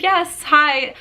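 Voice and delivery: retold eager reply. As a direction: relive answering an exciting call. Her excited reply on the call.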